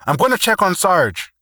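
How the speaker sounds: high, loud shout over gunfire; yelling. Acted. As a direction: call it out over the noise. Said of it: "Matthews yelled at Taylor".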